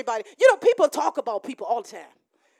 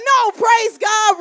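calling out praise; 6 semitones higher, 8 dB louder, slower than usual. Pretend. Correcting Reuben in the acted scene.

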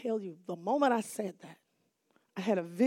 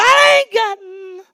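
loud, much higher cry, cut off; breaking shout; 10 semitones higher, 16 dB louder, slower than usual. Retold or imitated. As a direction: shout it and break off mid-word. Teacher about to refuse again.

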